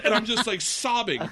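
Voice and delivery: high, laughing recount of sobbing; laughing retelling. Real, not acted. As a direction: tell it through laughter, voice up high. Retelling crying on the ride.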